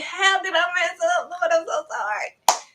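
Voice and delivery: high-pitched plea to the Lord; high, anxious mock apology; upset apology. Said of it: "I'm like".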